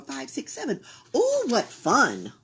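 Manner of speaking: usual speaking voice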